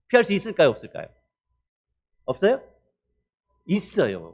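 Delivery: ordinary talk, nothing marked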